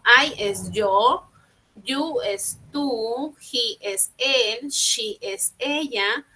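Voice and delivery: slow, clear teacher drill. Shown as a demonstration. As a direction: spell each pronoun out slowly for beginners. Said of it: "teacher drills pronouns slowly".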